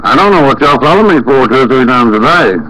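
usual speaking voice

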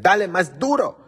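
loud, high egging-on shout; mock crowd cheer. Imitating the TV audience cheering the fight, loud and high. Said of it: "Y decían".

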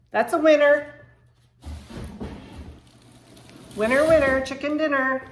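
loud, happy cheer over a table find; excited cheer. Real, not acted. Unboxing a table she loves, loud excited cheer. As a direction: cheer it out like a game show winner.

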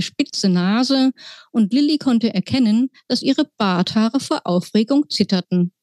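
ordinary delivery

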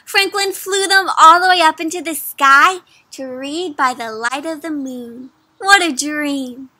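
ordinary delivery

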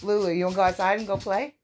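high, quick cooing invitation; coaxing pet talk. Real, not acted. Mom inviting her dog to play outside, high coaxing.

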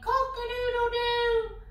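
high sung cock-a-doodle-doo; rooster crow. Acted. Preacher imitates the rooster crowing at Peter's denial, high and sung. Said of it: "the cock went".

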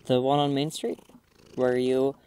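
ordinary talk, nothing marked

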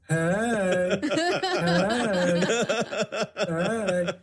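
a hint of a drawn-out friendly hey call; ringtone greeting. Ringtone going off at a Lions Club meeting, a friendly hey call. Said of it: "and it was".

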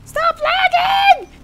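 high, drawn-out shout; frantic yell. Real, not acted. Player to his lagging game, frantic high yell. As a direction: yell it slowly at a very high pitch.

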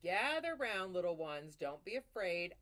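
loud, booming storyteller wolf; big bad wolf voice. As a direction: gather the little ones round like a grinning wolf. Wolf narrator addressing children.

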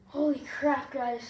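loud relieved exclamation; excited relief. Real, not acted. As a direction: burst out after the last rep. Relief after finishing sit-ups.

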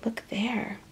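ordinary delivery

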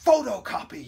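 high, loud exclamation falling at the end; eager loud shout; yelled idea. A narrator's reading. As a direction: call out a sudden idea. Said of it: "yelled Cole".

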